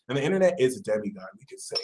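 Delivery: ordinary talk, nothing marked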